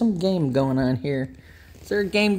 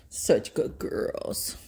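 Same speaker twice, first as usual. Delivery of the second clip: slow, whispered and softer; 3 semitones lower, 6 dB quieter, slower than usual. Real, not acted. Owner praising her dogs before leaving, slow whisper. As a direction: breathe it slowly, tenderly.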